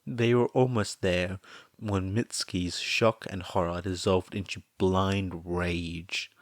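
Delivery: ordinary delivery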